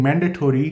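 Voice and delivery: "mandatory" with a full "tory" ending; Canadian pronunciation. Shown as a demonstration. Quoting what everyone in Canada says, "mandatory" with a full -tory ending. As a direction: say "mandatory" as MAN-duh-tory. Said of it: "everyone in Canada is saying".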